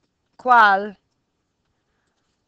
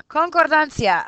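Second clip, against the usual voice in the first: mock exasperated; 7 semitones higher, 8 dB louder than usual. Shown as a demonstration. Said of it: "Ay, ay, ay".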